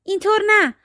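high-pitched, lightly voiced exclamation; mild cry of protest. A narrator's reading. Witch's daughter correcting her captive on the paddle, mild high cry. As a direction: call it out high without real volume. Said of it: "دختر جادوگر فریاد زد".

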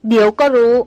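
loud menacing growl through gritted teeth; threaten, teeth clenched. A narrator's reading. Angry woman warns the men she'll show them, growling. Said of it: "หล่อนคำรามรอดไรฟันออกมา".

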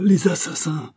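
loud, high repeated cry; the cry again, now full out and loud. A narrator's reading. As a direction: shout it out, fully horrified. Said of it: "s'écria le docteur".

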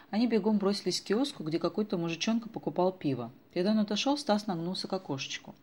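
ordinary delivery, nothing marked

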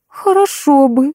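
slow, stretched-out reply; drawn out. A narrator's reading. Kira accepts the offer of tea, slowly drawn out. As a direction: let the words drag lazily. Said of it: "протянула она".

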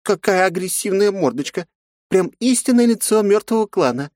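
high mocking jibe; snide teasing. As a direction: needle her with a sneer. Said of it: "съехидничала бандитка". (ехидно, с насмешкой)

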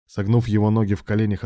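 plain reading voice

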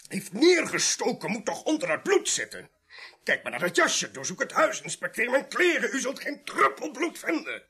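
high-pitched, indignant denial; agitated protest. Acted. Suspect protesting he is no killer to police, agitated. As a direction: plead innocence urgently, pitch leaping.

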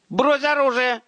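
put-on high, hysterical boyish shriek; shrill command on the edge of hysteria; breaking falsetto yell. A narrator's reading. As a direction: scream the order in a panicked falsetto. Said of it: "срывающимся фальцетом заорал Павел".

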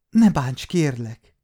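high, tearful begging; a scared child begging for mercy; sniffling plea. Acted. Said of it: "mondta szipogva". (szipogva könyörögve)

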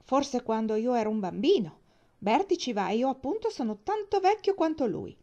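high, loud indignant protest. A narrator's reading. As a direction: argue like an outraged boy. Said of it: "esclamò Jem indignato".

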